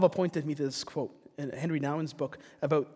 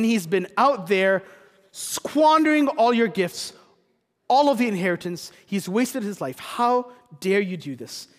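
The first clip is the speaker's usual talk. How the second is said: loud, higher outraged protest at the father; angry elder son; 5 semitones higher, 7 dB louder than usual. Retold or imitated. Elder son confronting his father, acted angry shout. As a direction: hurl the accusation, building to a shout. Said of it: "the elder son is really angry with his father".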